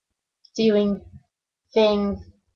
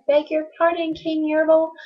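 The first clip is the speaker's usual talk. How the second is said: higher, small pleading voice; higher timid turtle, fading at the end; timid little turtle; 6 semitones higher than usual. Acted. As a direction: beg politely from the bottom of the stack. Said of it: "this plain little turtle looked up and he said".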